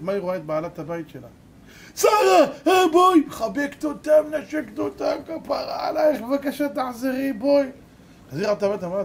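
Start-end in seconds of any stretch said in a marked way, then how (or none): 2.0-3.2 very high, loud cry on finding her; acted shriek of reunion; overjoyed calling out. Landlady finds the girl, calls her. 3.3-7.7 high-pitched gushing entreaty; acted high, imploring voice; effusive pleading. Landlady hugs and begs her back.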